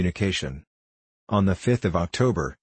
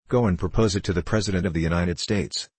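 plain reading voice

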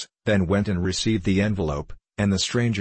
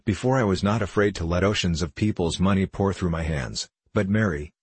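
ordinary delivery

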